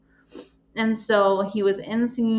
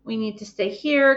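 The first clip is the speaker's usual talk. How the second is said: retold teacher; 4 semitones higher, 6 dB louder than usual. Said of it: "the teachers are like".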